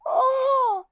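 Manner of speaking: sudden high yelp of fright; imitating a scared client's yelp; startled cry. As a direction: cry out as the dog lunges. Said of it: "The person goes".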